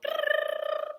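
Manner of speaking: high rolling brrrr with the lips; playful high lip buzz; lip trill drum roll. Shown as a demonstration. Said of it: "I usually go".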